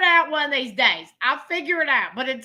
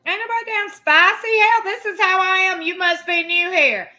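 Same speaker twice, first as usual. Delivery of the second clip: higher, louder, sassy; sassy retort; 6 semitones higher, 5 dB louder than usual. Real, not acted. Streamer sassing a new viewer, high and loud. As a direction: put the chat in its place.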